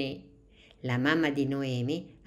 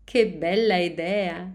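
louder, higher burst of delight; delighted teacher; 4 semitones higher, 5 dB louder than usual. A narrator's reading. Teacher praises Noemi's idea, louder with delight.

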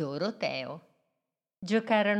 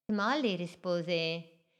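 plain reading voice

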